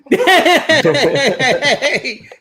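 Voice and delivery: high, loud peal of laughter; long real belly laugh; big laugh. Real, not acted. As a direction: crack up loudly at the joke. Laughing at the joke.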